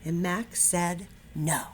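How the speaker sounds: lively picture-book read-aloud; animated storybook narration; reading to children. A narrator's reading. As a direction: read it like storytime for first graders. Teacher reads picture book aloud.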